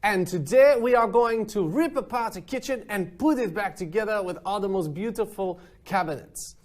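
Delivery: high, hammed-up French accent. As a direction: play the over-the-top French host. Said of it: "I'm gonna stop speaking in my French accent now".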